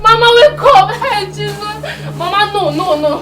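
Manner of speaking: high, loud sobbing wail, begging her to wake; keen over her like your world just ended; wailing grief. Acted. Granddaughter begging her dead grandmother to wake up, sobbing and wailing.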